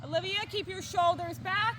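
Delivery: calling out loud across the arena; brisk projected riding commands. Real, not acted. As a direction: project it to a rider far away. Instructor calling to rider.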